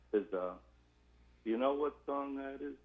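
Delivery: ordinary talk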